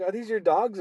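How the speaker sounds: high-pitched innocent question; imitated older couple. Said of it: "They look over at me".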